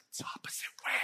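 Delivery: hushed whisper from the crowd; stage-whispered correction. Retold or imitated. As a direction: whisper it like a prompt from the pews. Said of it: "Everybody's like".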